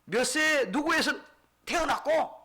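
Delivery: high, loud, emphatic recital; full-force preaching. Real, not acted. Preacher summing up the bare genealogy, full-force preaching. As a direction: hammer out each item to the congregation.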